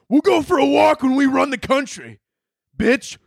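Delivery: high, manic bullying yell; mock raging bully. Adderall stomping on joy, mock rage. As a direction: rant it loudly at a high pitch.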